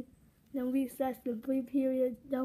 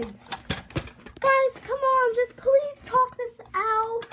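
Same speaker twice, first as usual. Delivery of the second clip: high, loud pleading to stop the fight; 9 semitones higher, 6 dB louder, much slower than usual. Acted. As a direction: beg them to make up, voice up high. Pleading with quarreling friends.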